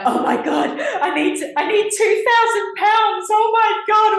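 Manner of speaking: high, loud panicked wail, half laughing; mock panic. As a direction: shriek it out like a drama queen. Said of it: "I was like".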